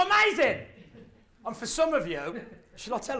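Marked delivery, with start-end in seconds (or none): 0.0-0.6 high, loud burst of joy; excited shout. Retold or imitated. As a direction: sell it, totally thrilled. His enthusiastic reply.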